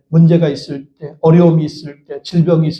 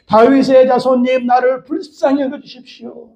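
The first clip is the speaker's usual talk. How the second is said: crying out; 4 semitones higher than usual. Shown as a demonstration. Preacher leads congregation calling on Jesus like Bartimaeus, crying out. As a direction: stretch the plea out on a raised, held pitch. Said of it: "한 번 더 외칩시다".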